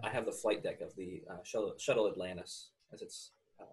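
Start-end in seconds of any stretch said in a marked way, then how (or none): none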